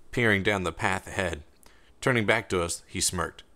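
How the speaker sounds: ordinary delivery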